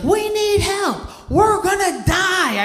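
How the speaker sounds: very high, slow, stretched shriek; mother screaming for help as the canoe sinks; panicked scream. Retold or imitated. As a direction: wail it out like mom in the water. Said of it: "what she screams is this".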